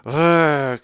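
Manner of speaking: loud annoyed ugh; a short fed-up groan at full force; teen's angry ugh; mock exasperated grunt. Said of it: "she'd come at me with this".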